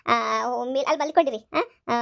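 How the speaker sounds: normal talking voice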